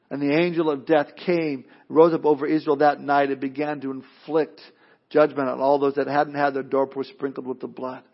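ordinary talk

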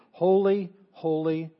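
slow, proclaimed "Holy, holy"; emphatic repetition. Retold or imitated. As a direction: ring out each "holy" slowly and strongly. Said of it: "holiness is the only thing that's emphasis".

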